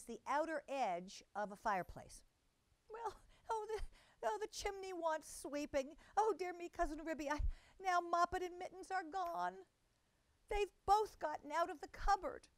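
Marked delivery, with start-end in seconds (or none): 2.9-12.5 high, flustered mother-cat voice. A narrator's reading. Tabitha panics over her missing kittens, high and flustered. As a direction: stammer it out high and fretful.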